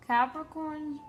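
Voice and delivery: loud, high hype for Capricorns; excited cheer. Real, not acted. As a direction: hype up your sign. Cheering for her own sign.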